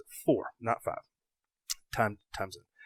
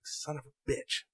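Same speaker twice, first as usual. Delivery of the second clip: softer, slow grumble under breath; quiet muttered curse; 3 semitones higher, 12 dB quieter, slower than usual. Retold or imitated. Fan losing signal mid-game, muttering a curse. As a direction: mutter it, frustrated but half amused.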